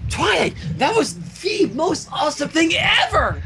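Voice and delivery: high, thrilled gush of admiration; excited cheer. Acted. Rainbow Dash gushing to Twilight, high and thrilled. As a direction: gush like a starstruck fan.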